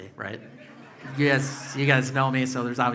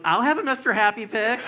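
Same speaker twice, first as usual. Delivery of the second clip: higher, louder eager wife; wife's eager voice; 9 semitones higher, 6 dB louder than usual. Retold or imitated. Comic voices the wife wanting a Mister Happy pic, high and loud. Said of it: "his wife goes".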